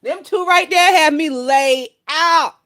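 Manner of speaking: normal talking voice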